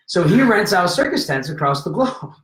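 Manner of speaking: usual speaking voice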